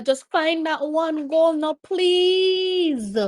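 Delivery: high plea with a long-drawn please; beg, climbing high and stretching the last word; desperate begging. Real, not acted. Fan begging Jamaica for one goal, desperate plea.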